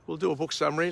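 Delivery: normal talking voice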